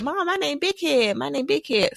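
put-on high, slow childish announcement; little kid voice. Four-year-old tells her mom her new name, high and proud. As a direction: play yourself at four, proud. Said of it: "was like".

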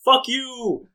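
high-pitched parting insult; acted angry retort. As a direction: throw it back high, then storm off. Olivia's parting insult in quarrel.

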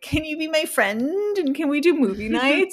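put-on high, pleading kid voice; whiny lonely teen. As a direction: beg sweetly like a lonely teenager. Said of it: "was like".

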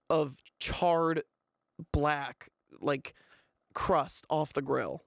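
usual speaking voice

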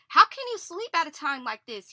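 shouted. A narrator's reading. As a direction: shout it at the sleeper over the storm. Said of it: "he shouted".

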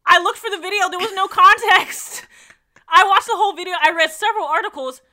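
loud, high-pitched exasperation; loud and worked up, dragging out the complaint; exasperated protest. Real, not acted. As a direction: protest at the top of your voice, incredulous. Exasperated protest about missing context.

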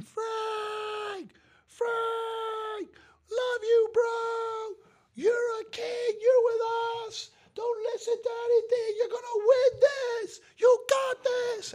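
very high, straining fan scream, almost crying out. Retold or imitated. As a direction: scream your heart out at the quarterback. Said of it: "I start screaming".

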